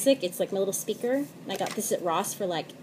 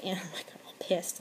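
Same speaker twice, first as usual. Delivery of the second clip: whispered aside; 11 dB quieter than usual. Real, not acted. Aside about getting pissed.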